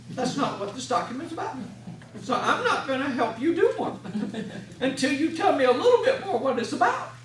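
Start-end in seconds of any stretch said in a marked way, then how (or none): 2.2-7.1 laughs through a playful refusal; amused, higher and louder with laughter; laughing while talking. Real, not acted. To the audience, a laughing half-joking refusal.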